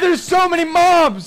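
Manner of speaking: real high, loud panicked scream. Real, not acted. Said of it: "I, I screamed".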